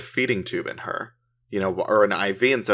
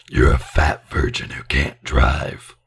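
demon voice; 8 semitones lower, 4 dB louder than usual. Pretend. Voicing the demons' insults.

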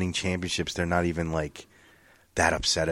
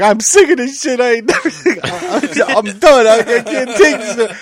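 crying Buffalo fan; 12 semitones higher, 8 dB louder than usual. Retold or imitated. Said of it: "And he's like".

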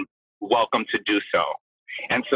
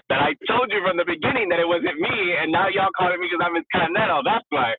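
sassy rant; 8 semitones higher than usual. Real, not acted. To the producers who finally called her, a sassy laughing rant. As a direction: let them have it, laughing at the nerve. Ranting at the show that dropped her.

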